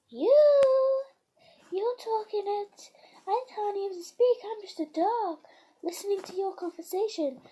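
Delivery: high squeaky dog voice; dog's voice. Pretend. Girl voicing her dog answering who's talking. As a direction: speak as the dog, high and bright.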